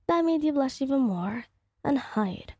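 ordinary delivery, nothing marked

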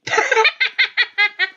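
high, staccato laugh; high gloating cackle; cackling laugh. Real, not acted. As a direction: laugh in a rapid string of bursts. Laughs after two rage kills.

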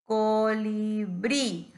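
COLIBRÍ very slow, each syllable held; drawn-out demonstration. Shown as a demonstration. Teacher letting the repeated I be heard, COLIBRÍ drawn out. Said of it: "como que suena varias veces".

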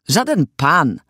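mild indignant shout; exclaimed. A narrator's reading. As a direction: cry out in protest. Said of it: "zakrzyknęła armata".

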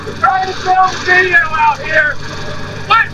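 shouting far across the yard; yelling. Real, not acted. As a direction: yell out to dad, high and strained. Yelling at dad from outside.

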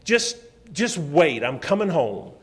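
irritated dad telling his son he's coming home; angry on the phone. Retold or imitated. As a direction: bite off the words, barely holding anger. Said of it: "I was mad".

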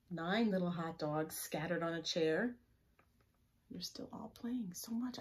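ordinary delivery

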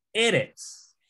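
eretz with the first e punched high; stress on first syllable. Shown as a demonstration. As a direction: hit the opening syllable of eretz, then fall. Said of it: "con énfasis aquí".

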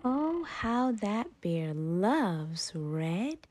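ordinary delivery, nothing marked

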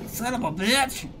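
high squeaky clown curse. Pretend. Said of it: "kept the clown voice the whole time".

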